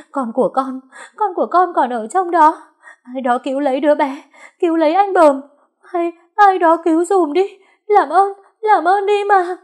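high, loud hysterical wail; crying scream. A narrator's reading. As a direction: scream for help for your child. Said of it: "Mận khóc thét".

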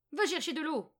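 loud, high witch's order; old woman calling out. A narrator's reading. Old woman sends Margot for water, loud high command. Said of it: "appela-t-elle".

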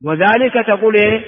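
ordinary talk

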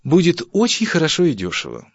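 wife delighted with her plans; joyful. Acted. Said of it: "радостно говорила". (радостно, высоким голосом)

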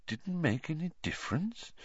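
very soft questioning echo; quiet. A narrator's reading. Headmaster questioning the pupil's words, quietly. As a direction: repeat it softly, rising in question. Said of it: "said Dumbledore quietly".

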